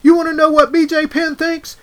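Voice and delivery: hammed-up B J Penn hype voice; imitating B J Penn. Quoting B J Penn to his podcast cohost, high and loud. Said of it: "He says".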